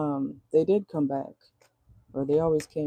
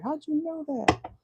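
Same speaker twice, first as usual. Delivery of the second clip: high, slow, stunned "how'd you know"; mock surprise; 12 semitones higher, slower than usual. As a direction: act caught red-handed and amazed. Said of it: "it's like".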